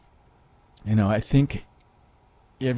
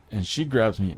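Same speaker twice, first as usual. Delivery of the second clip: normal talking voice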